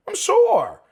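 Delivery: squealed high, mock certainty; sarcastic high-pitched I'm sure. Second take much higher.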